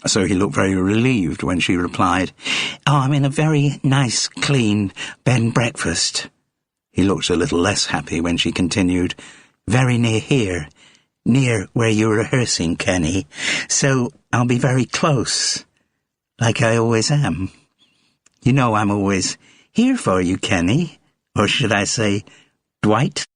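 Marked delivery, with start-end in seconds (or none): 2.9-6.3 pleased with herself, pitched up; American fan. Acted. Gloria tells Kenny about her bed and breakfast, high American voice. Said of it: "Her accent was American". 9.7-23.2 sweetly possessive, pitched up; clingy American fan. Acted. Gloria reminds Kenny she is always close by, high and clingy.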